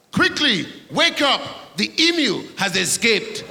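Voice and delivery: high, loud urgent Australian dad; mock Australian father alarm. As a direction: wake the kid in a panic, Aussie accent. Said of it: "and your dad goes".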